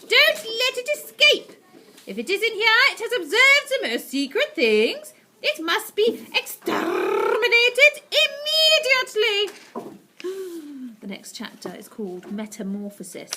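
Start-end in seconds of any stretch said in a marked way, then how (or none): 0.0-9.5 screeching witch. Acted. Grand High Witch orders. 10.2-11.0 gasp. Acted. Frightened narrator.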